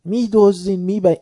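high, loud accusing yell; accusing shout. Retold or imitated. Cleric yelling thieves at the shah's men. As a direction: hurl the accusation at them. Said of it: "شروع کرد داد زدن".

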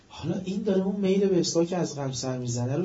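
ordinary talk, nothing marked

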